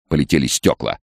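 ordinary delivery

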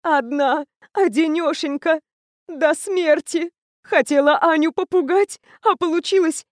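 high, slow tearful lament; a lonely woman weeping as she speaks; talking through sobs. A narrator's reading. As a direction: wail it through crying. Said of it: "продолжала рыдать, выговаривая сквозь плач".

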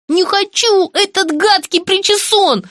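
high, loud bratty whining; whiny tantrum. Acted. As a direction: throw a spoiled-child tantrum. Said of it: "закапризничала девица".

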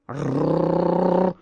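long rolled-r growl; animal growl. A narrator's reading. Cat and caged beasts in a standoff, growling. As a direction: trill out a sustained growl.

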